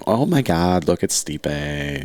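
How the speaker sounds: slow, high, teasing gasp; mock gushing fan. Teasing his friend in the hospital, mock starstruck gasp. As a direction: pretend to be starstruck to tease him.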